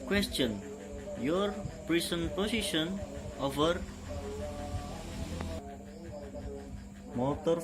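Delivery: calm radio operator call; marine radio. Shown as a demonstration. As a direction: read it like a VHF radio exchange. Radio call demo dialogue.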